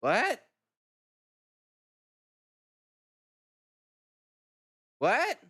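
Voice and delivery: sharp high disbelieving What; a real jolt of surprise, asked high; shocked what. Real, not acted. Streamer to chat, stunned by the result, high incredulous What.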